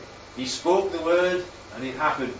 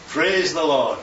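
ordinary delivery

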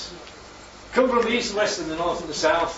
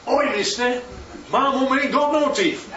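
higher, louder cheeky lad, laughing through it; cheeky street lad; 8 semitones higher, 4 dB louder than usual. Retold or imitated. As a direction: pipe up like a cocky London kid. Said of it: "the little lad says".

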